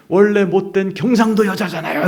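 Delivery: high, loud retort bursting out; re-enacted angry protest; blurted outburst. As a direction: blurt it out in indignation. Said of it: "이렇게 튀어나왔어요".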